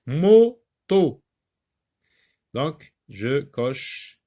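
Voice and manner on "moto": "Moto" split mo... to, high and loud; two separated beats on "Moto", one per box; teacher dividing "Moto" loudly into syllables. Shown as a demonstration. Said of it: "deux cases".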